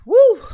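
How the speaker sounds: a big, high, loud exhale to steady herself; loud, high exhale before a deep breath; loud shaky release of breath. Real, not acted. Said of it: "Okay, deep breath".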